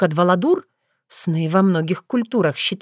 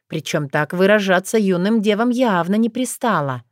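plain reading voice

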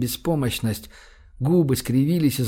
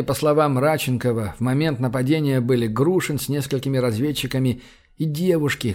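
usual speaking voice

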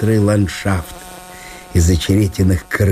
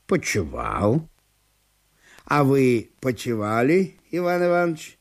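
soft, lazy reclining voice; host on the floor apologises softly to his guest; lazy host apologising; 4 semitones higher, 6 dB quieter than usual. A narrator's reading. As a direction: apologise without getting up. Ivan Nikiforovich replies.

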